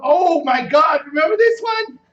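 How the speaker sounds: loud, high excited outburst; excited shout. Real, not acted. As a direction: shout it out in disbelief. Excited at finding the game.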